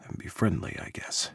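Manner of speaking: dropping to a whisper; fading to a whisper. A narrator's reading. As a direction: let it fade to nothing. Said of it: "His gruff voice faded away".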